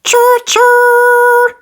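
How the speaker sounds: very high, loud choo-choo; a little boy playing train; shrill happy train call; toddler's cheer. A narrator's reading. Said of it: "said George".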